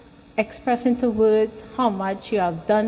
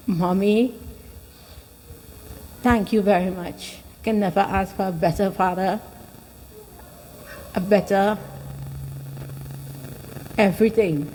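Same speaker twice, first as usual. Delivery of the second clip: emotional; 3 semitones lower than usual. Real, not acted. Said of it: "getting a little emotional here".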